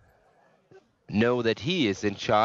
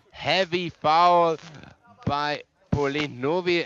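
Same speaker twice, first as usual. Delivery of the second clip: drawn-out, louder, higher call; excited call; 3 semitones higher, 3 dB louder, slower than usual. Real, not acted. Commentator to listeners as a foul happens, excited.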